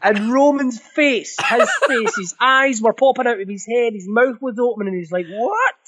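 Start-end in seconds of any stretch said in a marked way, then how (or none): 5.3-5.8 a high, disbelieving yelp; stunned what. Retold or imitated. Roman, seeing the baby kicked, gasps in shock. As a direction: squeak it out in pure shock.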